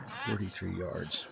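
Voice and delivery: soft, slow, defeated; deflated murmur. Real, not acted. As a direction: barely say it, deflated. Deflated after a bad shot.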